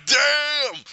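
loud, high burst of amazement; excited exclamation. Retold or imitated. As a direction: shout it out in awe. Excited reaction retold.

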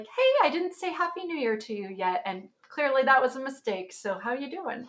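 lightly voiced cheerful friend; bubbly friend. Reading Gabrielle's New Year message, lightly voiced cheery friend.

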